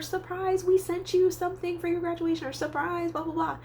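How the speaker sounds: high singsong relatives' reveal; high mock-excited voice, easing slightly; imagined surprise announcement. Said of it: "expecting them to say".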